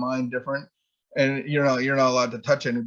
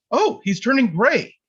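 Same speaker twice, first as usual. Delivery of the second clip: put-on alarmed girls; 8 semitones higher than usual. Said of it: "all I heard was from the crowd of girls".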